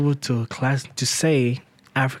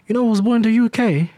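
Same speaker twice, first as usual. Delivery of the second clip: put-on British accent; 7 semitones higher than usual. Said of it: "Now I feel like doing my British accent".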